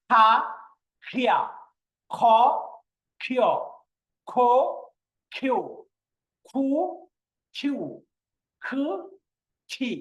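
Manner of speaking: each syllable from খা to খি with a breathy "kh" burst; forceful aspiration. Shown as a demonstration. Teacher asking students to repeat, খ strongly aspirated. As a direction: press from the throat on খ, releasing air. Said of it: "একটু pressure দিতে হবে".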